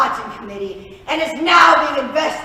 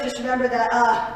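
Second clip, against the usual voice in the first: ordinary delivery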